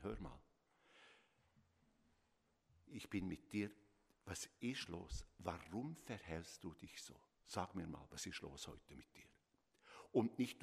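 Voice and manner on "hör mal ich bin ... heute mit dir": caring parent asking instead of accusing; calm questioning parent. Shown as a demonstration. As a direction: ask the child kindly what is wrong. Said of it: "ich kann dem Kind ganz klar sagen".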